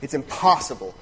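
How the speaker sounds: normal talking voice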